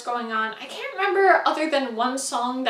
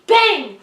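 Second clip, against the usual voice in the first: mock gunshot; 6 semitones higher, 7 dB louder than usual. Imitating the gunshot.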